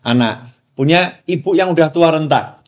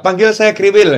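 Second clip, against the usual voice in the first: mock request; 7 semitones higher, 4 dB louder than usual. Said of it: "dia suka gitu ya".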